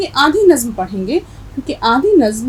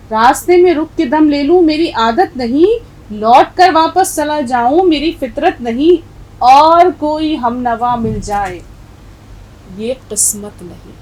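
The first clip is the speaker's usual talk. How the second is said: loud, emphatic verse recital, easing on the last line; poem recitation; 3 semitones higher, 6 dB louder than usual. Acted. Teacher quoting the poet's verse to her class, emphatic recitation. तो शायर यही कहता है.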